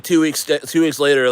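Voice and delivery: normal talking voice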